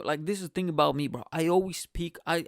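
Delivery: ordinary talk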